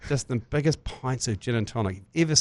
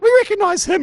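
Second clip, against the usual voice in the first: much higher put-on voice, as the shocked parents; parents spotting him; 4 dB louder than usual. Bailey's parents spotting Jerry in Speedos, high mock voice. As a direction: squeal it out like a startled parent. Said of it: "and they're like".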